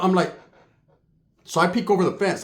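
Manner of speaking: ordinary talk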